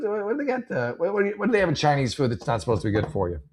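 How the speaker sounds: usual speaking voice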